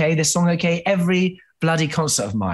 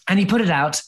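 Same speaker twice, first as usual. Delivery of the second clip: usual speaking voice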